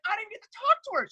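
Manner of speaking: high, fast indignant protest. Retold or imitated. Prisoner protesting when the guard cuts his call, high and fast. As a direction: blurt it out, wronged.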